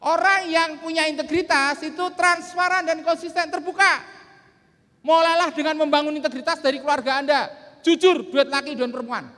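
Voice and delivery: loud, high-pitched motivational delivery; rousing seminar declamation. Real, not acted. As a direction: drive each point home to the hall. Declaims the moral to the hall.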